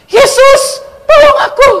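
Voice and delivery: high, loud cry for help; desperate shout. Retold or imitated. Kneeling in the street calling Jesus, desperate shout. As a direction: yell a pleading prayer at full pitch. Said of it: "saya langsung teriak".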